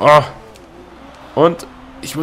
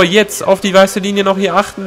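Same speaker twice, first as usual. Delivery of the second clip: ordinary talk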